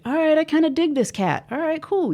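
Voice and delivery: high, light and casual self-talk; cool self-approval. Acted. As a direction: say it like easygoing approval of yourself, pitched well up. Acting out accepting self-talk.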